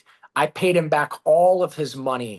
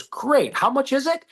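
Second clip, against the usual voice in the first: eager businessman; 10 semitones higher than usual. Retold or imitated. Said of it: "And he goes".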